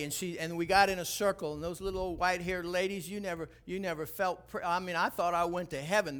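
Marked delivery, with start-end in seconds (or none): none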